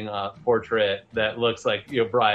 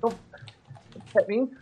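hedgeling; 10 semitones higher, 4 dB quieter than usual. Acted. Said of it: "he's like".

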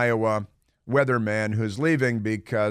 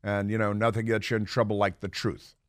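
ordinary delivery

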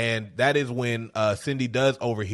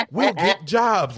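put-on naive kids' plan; 6 semitones higher, 4 dB louder, much slower than usual. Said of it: "They're just like".

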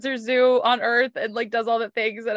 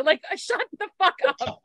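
laughing exasperation; 8 semitones higher than usual. Real, not acted. Cracking up at the name.

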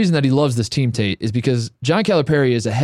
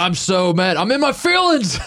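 hammed-up upset coach voice, much higher; mock coach; 7 semitones higher than usual. Co-host mocks the coach ripping his shirt, hammed-up pout. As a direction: play the coach sulking big, mock hurt.

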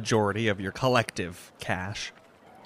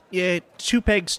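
repeated price; 8 semitones higher, 3 dB louder, slower than usual. Acted.

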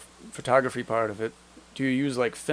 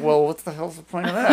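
loud, high, laughing mock outrage; laughing exasperated quote; 4 semitones higher, 7 dB louder than usual. Podcaster retelling his reaction to new mics, laughing loudly. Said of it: "I'm like".

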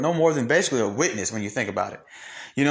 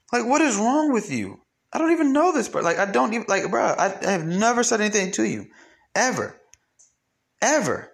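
exasperated rant; 8 semitones higher than usual. Real, not acted.

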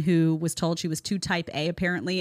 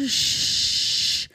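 shushing. Shown as a demonstration. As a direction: hush her with a sharp shh. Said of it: "it's just like".

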